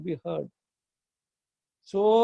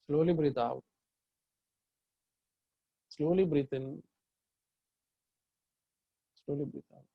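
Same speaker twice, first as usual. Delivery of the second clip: guided meditation. Real, not acted. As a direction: lead them gently through the breath. Leads a guided chakra meditation.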